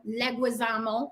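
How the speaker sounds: "Leguizamo" said leg-wih-ZAH-mo; "Leguizamo" with gui as gwi; Americanized pronunciation. Shown as a demonstration. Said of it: "however you wanna pronounce it".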